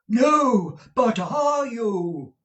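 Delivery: put-on florid, theatrical old suitor, high and slow; old gentleman. A narrator's reading. Dotty old gentleman courting Mrs Nickleby over the wall, grand and slow. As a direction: woo her grandly like a dotty old gallant.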